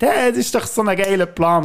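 mock-excited sarcasm; cynical mock enthusiasm. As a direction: sell the plan with fake glee. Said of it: "der sagt".

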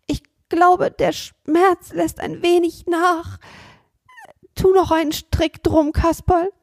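hammed-up sick boy.

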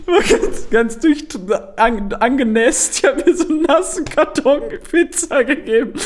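high, giggling through words; barely holding back laughter; laughing while talking. Real, not acted. Still laughing at own story.

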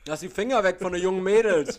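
loud, high laughing farewell; mock shouting goodbye. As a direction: bellow the warning, laughing.

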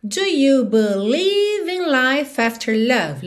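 slow, "life after" run together as "lai-fafter"; linked "life after". Shown as a demonstration. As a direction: stretch "believe", link "life after". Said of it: "o F já juntou na vogal A".

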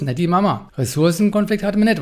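ordinary talk

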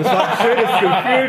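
laughing through the words, high and slow; amused laugh breaking in mid-phrase. Real, not acted. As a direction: chuckle out a beautiful feeling. Laughing at the rocket memory.

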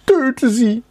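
high-pitched, sharp command; maid demanding death. A narrator's reading. Maid ordering butler to kill mice, high demand.

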